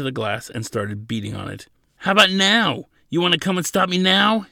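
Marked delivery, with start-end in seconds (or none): 2.0-4.5 high, loud taunting question; yelled challenge. A narrator's reading. Prisoner beating the glass challenges the officer, yelling. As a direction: yell it as a provocation. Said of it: "he yelled".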